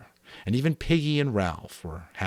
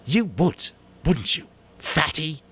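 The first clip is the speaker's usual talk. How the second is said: put-on high, sneering boy's voice; vicious Jack; 9 semitones higher, 4 dB louder than usual. A narrator's reading. Jack jeering at Piggy, high and vicious. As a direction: spit it out with humiliated spite. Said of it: "His voice was vicious with humiliation".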